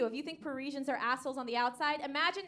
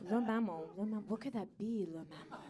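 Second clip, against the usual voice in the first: low, soft musing that trails away; mock puzzlement; 4 semitones lower, 9 dB quieter than usual. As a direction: ponder it to yourself, fading out. Woman puzzles over the phrase.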